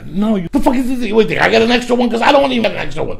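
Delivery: high-pitched, rushed comic rant; mock-outraged refusal. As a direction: act out exasperated squawking at a beggar. Acted kitchen refusal to guys begging ribs.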